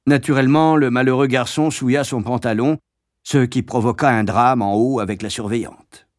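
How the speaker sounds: plain reading voice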